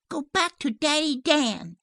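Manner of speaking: put-on high, loud child's order; commanding little girl. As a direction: send the dog off in a child's shout. Child orders the dog away.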